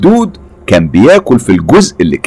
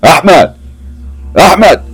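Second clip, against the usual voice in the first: raised, high-pitched call; calling out loudly; 8 semitones higher than usual. A narrator's reading. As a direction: call out for someone, rising higher on the repeat. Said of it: "قال بصوت عالي". (نداء بصوت عالي)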